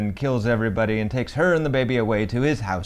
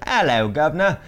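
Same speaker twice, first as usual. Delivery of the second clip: higher, exaggerated British greeting; cheery caricature of a British accent; hammed-up British accent; 5 semitones higher, 4 dB louder than usual. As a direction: do a comedian's overdone British accent. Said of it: "you try to do an Australian accent".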